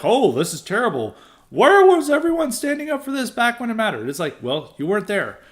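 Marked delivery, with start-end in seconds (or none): 0.0-4.1 mock-outraged, rising high on the second question; hammed-up indignation, pitch climbing; mocking latecomers. Mocking late conservatives who now act outraged, hammed-up indignant. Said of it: "now they're like".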